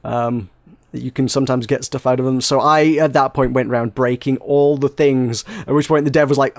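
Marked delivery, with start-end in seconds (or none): none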